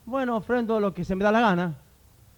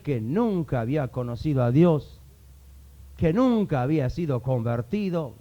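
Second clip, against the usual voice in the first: usual speaking voice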